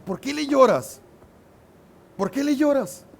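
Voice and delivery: scolding. Retold or imitated. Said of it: "Y lo regaña".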